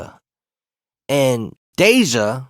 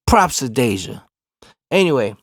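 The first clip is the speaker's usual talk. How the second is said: ordinary delivery, nothing marked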